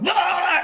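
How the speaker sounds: high loud mock yelp; hammed-up dog freakout. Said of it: "he'd just go".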